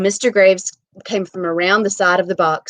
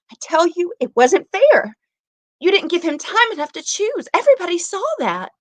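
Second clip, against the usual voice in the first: panicked pleading; 11 semitones higher, 4 dB louder than usual. Acted. Tessie pleading, rising panic.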